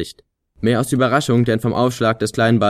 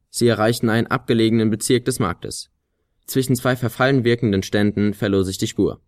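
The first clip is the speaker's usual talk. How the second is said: ordinary delivery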